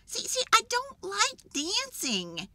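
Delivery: high, insistent character voice; worked-up, high-pitched complaint; exasperated protest. A narrator's reading. Jim protests against the party's dancing.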